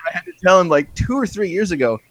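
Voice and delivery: ordinary talk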